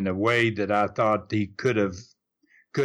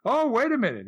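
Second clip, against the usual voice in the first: higher, a light imitation of Rob caught off guard; a touch of Rob's disbelief, pitched up; lightly voiced startled Rob; mock surprise; 11 semitones higher, faster than usual. Retelling Rob's surprised reaction.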